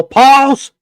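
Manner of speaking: high, loud shouted command; yell. Shown as a demonstration. Host shows Aaron how to halt the video, yelling. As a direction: yell it out to stop playback. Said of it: "you just have to yell".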